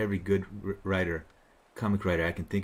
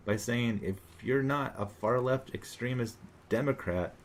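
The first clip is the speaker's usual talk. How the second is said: normal talking voice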